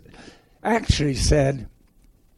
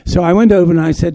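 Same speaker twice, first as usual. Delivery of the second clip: normal talking voice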